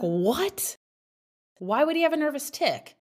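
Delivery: high-pitched, quick disbelieving "What? Why would he"; retold shock. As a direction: sound caught off guard by the news.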